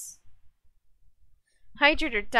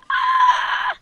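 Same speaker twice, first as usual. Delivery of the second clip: loud, very high scream; 8 dB louder than usual. Real, not acted. Gamer in trouble mid-match, high scream.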